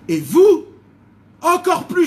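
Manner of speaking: sudden loud, rising rebuke; angry outburst. Acted. Elihu angrily rebuking Job's friends, loud outburst. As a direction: snap at the friends in anger.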